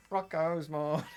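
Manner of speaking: ordinary talk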